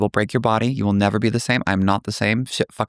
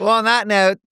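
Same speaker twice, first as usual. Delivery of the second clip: playful sign-off; 11 semitones higher than usual. Acted. Sign-off, higher and brighter.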